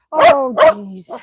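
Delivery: high, loud jolt of surprise; sudden high oh jeez; startled exclaim. Real, not acted. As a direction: yelp it out as the dog jumps. Dog excited.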